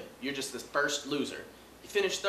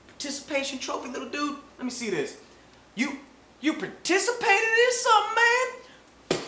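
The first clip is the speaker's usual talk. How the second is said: high, mocking baby-talk to a little kid; 11 semitones higher, 3 dB louder than usual. Mocking a little kid over his participation trophy, squeaky high voice. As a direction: tease him in a squeaky high voice.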